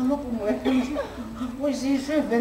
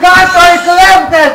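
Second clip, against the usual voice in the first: slow, very loud protest shout; drunken shout; 6 semitones higher, 14 dB louder, slower than usual. Acted. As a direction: roar it like a drunk at a rally. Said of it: "με βλέπεις, φωνάζω".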